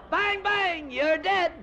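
put-on high, loud child shooting; a little cowboy yelling at his friend; kid playing cowboy. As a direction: shout it like a boy at play.

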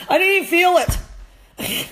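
high, slow whiny "didn't"; mock complaining dog. As the dog to its handler, mock whine, high. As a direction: play a dog that felt nothing, high and drawn out. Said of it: "I'd be sitting there saying to you".

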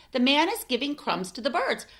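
plain reading voice

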